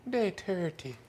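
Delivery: high put-on Irish lilt; Irish accent. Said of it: "it's like an Irish accent".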